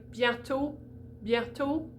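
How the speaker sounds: bientôt ending in a pure, tight "oh"; closed O. Shown as a demonstration. Teacher introducing closed O, tight rounded vowel. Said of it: "when I talk about a closed O".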